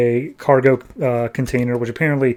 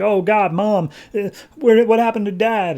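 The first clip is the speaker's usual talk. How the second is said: put-on panicked kids; 9 semitones higher than usual. Kids panicking in retold plot.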